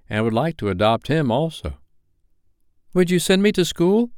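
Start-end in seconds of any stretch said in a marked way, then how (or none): 2.9-4.1 put-on high-pitched boy, mildly crafty; craftily. A narrator's reading. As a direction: sound him out with a slightly sly question. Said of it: "he inquired craftily".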